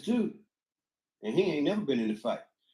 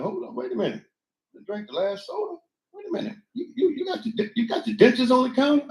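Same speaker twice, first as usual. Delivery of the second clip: high, mocking partner voice jumping up in pitch; 9 semitones higher than usual. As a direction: play the fussy roommate, voice rising higher and higher. Mock partner voice in relationship story.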